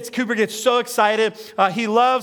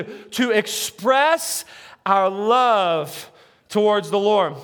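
ordinary talk, nothing marked

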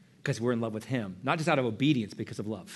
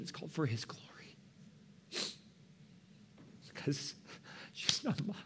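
hushed; 12 dB quieter than usual. Real, not acted. Emotional confession continues.

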